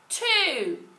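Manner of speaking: loud classroom count; shouted count. A narrator's reading. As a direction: shout the next number out. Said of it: "shouted room eight".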